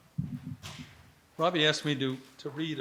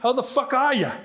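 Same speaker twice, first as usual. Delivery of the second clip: put-on higher, hearty Boston accent greeting; loud Boston accent; 7 semitones higher than usual. A narrator's reading. Johnny hails the doctor from the blankets, loud Boston accent. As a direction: boom it out in a thick Boston accent. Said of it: "a loud voice saying in a Boston accent".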